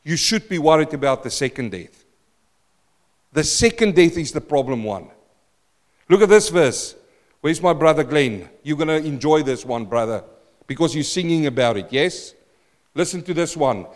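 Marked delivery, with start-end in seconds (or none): none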